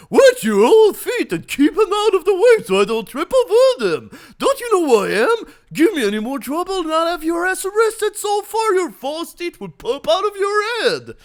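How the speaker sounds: loud, high, arrogant yelling; bully her at full volume; arrogant shouting. Acted. Bruce yelling at the old lady, arrogant shout.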